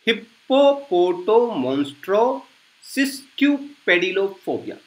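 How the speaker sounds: हिप्पोपोटोमोन्स्ट्रो सिस्क्यूपेडिलोफोबिया said slowly and softly, every syllable clear; quiet slow recap of हिप्पोपोटोमोन्स्ट्रो सिस्क्यूपेडिलोफोबिया; full word demo. Shown as a demonstration. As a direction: model हिप्पोपोटोमोन्स्ट्रो सिस्क्यूपेडिलोफोबिया as one careful run. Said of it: "कैसे, क्या बोला मैंने?".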